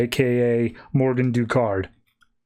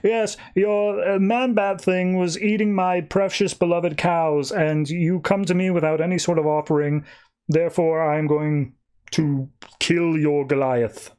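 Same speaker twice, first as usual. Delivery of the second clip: pompous king; 4 semitones higher than usual. Acted. King tells captive boy his man-bat ate the cows, pompous. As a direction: play a self-important king, drawing the words out. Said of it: "pompous king guy".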